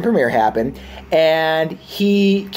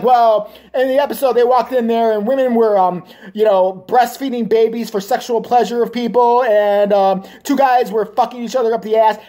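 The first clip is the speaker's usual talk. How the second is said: mock council lady; 6 semitones higher than usual. Mocking the council member's made-up complaint, prissy and indignant. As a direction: play the outraged council lady. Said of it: "She's like".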